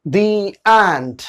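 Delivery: the said as thee before ant; "thee" before vowel. Shown as a demonstration. Teacher giving a second example of thee. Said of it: "तब sound आएगा दी".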